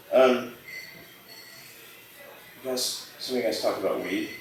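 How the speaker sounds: ordinary delivery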